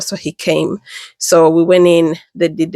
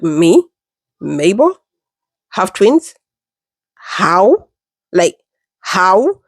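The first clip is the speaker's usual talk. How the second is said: re-enacted stunned questioning, each word rising; halting, incredulous rising questions; shocked disbelief; much slower than usual. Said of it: "so surprised, so shocked".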